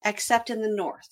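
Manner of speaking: normal talking voice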